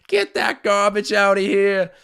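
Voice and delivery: put-on loud, high Boston accent call. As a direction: belt it like a Boston announcer. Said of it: "my Boston accent needs some work".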